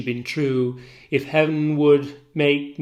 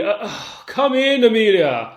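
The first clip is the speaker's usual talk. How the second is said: loud call through the door; shouting; 8 semitones higher, 8 dB louder than usual. Acted. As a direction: call her in loudly. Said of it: "Othello should be shouting".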